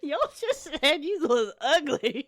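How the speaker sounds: drawn-out words through laughter; amused, slowed by real laughing. Real, not acted. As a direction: say it slowly while cracking up. Laughing at the device's insult.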